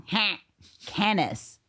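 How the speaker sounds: Canis rung out proudly; ringing proud Canis. Shown as a demonstration. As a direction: announce Canis with a flourish. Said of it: "it rings in your ears".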